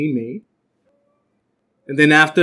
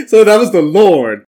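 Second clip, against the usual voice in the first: laughing; 6 semitones higher, 5 dB louder than usual. Real, not acted. Laughs at own confession.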